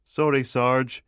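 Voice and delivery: small, deflated junior voice, pitched high; drooping apology. A narrator's reading. Scolded constable to his sergeant, drooping apology. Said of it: "Tufty's voice drooped".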